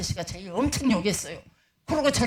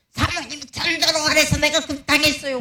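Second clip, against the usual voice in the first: lightly voiced complaining young man; 6 semitones higher, 4 dB louder than usual.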